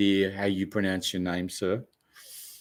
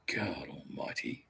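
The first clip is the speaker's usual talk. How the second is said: frustrated whisper; 7 semitones lower, 16 dB quieter than usual. Real, not acted. Frustrated with website, muttering.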